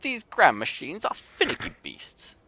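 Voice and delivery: a cross Yorkshire artificer grumbling; Yorkshire grumble. Acted. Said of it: "in a thick Yorkshire accent".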